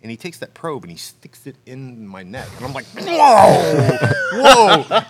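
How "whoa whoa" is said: high, loud startled whoa. Retold or imitated. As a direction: yelp it out in alarm. Reacting to probe in neck.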